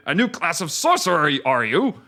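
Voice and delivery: put-on gruff Japanese-accented ninja. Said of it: "in a gruff Japanese-sounding accent".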